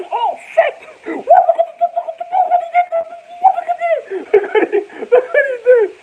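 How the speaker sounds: high, loud, laughing panic; high-pitched mock panic; frantic squeal. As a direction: squeal it out in shocked disbelief, half laughing. Retold reaction to being punched.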